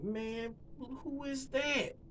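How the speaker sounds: high, soft, stretched-out wonder; acted awe, kept quiet and high-pitched; hushed amazement. Elbowing his homeboy about a woman, hushed high amazement.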